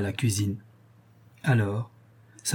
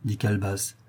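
usual speaking voice

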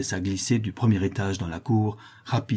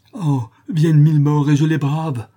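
high, loud, ecstatic outcry; intoxicated rapture; 6 semitones higher, 8 dB louder than usual. Acted. The notary, drunk with passion, braves a thousand deaths. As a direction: defy death in a frenzy of delight. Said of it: "dans un enivrement impossible à peindre".

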